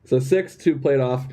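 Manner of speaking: ordinary talk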